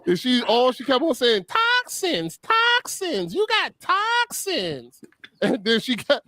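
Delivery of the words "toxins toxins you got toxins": high-pitched, drawn-out mimicry of the masseuse; imitated massage lady. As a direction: chant it high like an insistent little masseuse. Imitates massage lady's repeated line.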